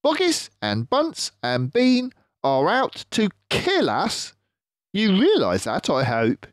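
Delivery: clever fox leader, pleased with himself; Mister Fox voice. Acted.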